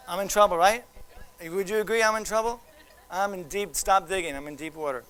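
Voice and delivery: ordinary delivery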